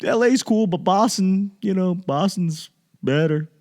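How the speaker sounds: mock smug hometown pride; smug Boston transplant. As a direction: brush LA off like a proud Bostonian. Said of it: "I was like".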